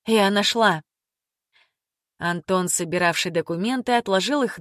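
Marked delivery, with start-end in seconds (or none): 0.1-0.8 raised voice after a whisper; louder call. A narrator's reading. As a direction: say it out louder now. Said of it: "добавила громче".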